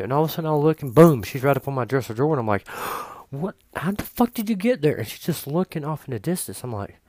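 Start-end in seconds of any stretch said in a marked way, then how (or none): 2.7-3.2 sharp gasp at a sudden sight; startled gasp. Retold or imitated. Owner finds the cat on the dresser, startled gasp. 3.3-5.0 higher-pitched, retold disbelief; startled outburst. Owner to cat suddenly on the dresser, startled.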